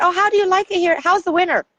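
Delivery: high, eager small-talk voice; chirpy retold question. Newcomer asking local couple about winter, high chirpy voice. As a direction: ask it high and bright, playing the newcomer.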